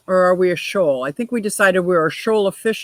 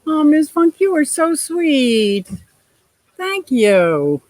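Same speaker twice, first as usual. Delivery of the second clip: high, slow, gushing praise; delighted to read a kind comment; 8 semitones higher, slower than usual. Real, not acted. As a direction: coo the thanks up high.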